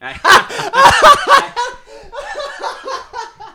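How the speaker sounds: long, high burst of laughter; loud laughter. Real, not acted. Lifter teased about his bench, loud high laughter.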